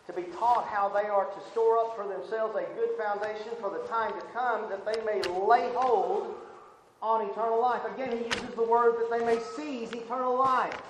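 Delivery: loud, high, pounding sermon delivery; fiery preaching. Acted. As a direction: hammer each phrase home to the congregation. Preaching at full force again.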